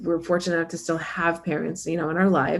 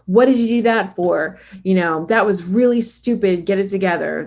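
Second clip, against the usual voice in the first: mean scolding parent; 5 dB louder than usual. Retold or imitated. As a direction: berate a child like a mean parent. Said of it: "that mean parent with the finger out".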